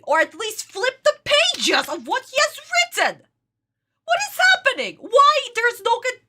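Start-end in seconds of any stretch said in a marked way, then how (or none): 4.0-6.2 high, louder exasperated disbelief; can't believe what's on screen; high-pitched exasperated cry; exasperated outburst. Real, not acted. Exasperated at the cartoon scene.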